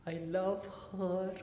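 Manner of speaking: put-on high, slow little boy's confession; acted child voice, high and drawn out. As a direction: declare it like a lovestruck small child. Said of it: "this boy holds on to his father and says".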